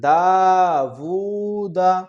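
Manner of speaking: دا وو دا read slowly, وو held long; a slow teaching blend of دا وو دا; stretched syllables. Shown as a demonstration. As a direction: pull out the وو of دا وو دا. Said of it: "دال کو جلدی اس لیے پڑھا".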